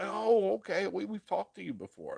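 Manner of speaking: high-pitched "oh, it's you" reaction; acted recognition. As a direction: replay a pleased moment of recognition. Acts out recognizing a fan.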